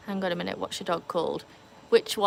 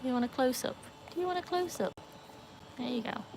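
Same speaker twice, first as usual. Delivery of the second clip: dog talk; 5 semitones higher, 8 dB quieter than usual. Real, not acted. Talking to the dogs.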